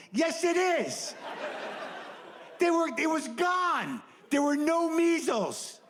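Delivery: high, slow incredulous protest; incredulous high pitch. Acted. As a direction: squeak out disbelief.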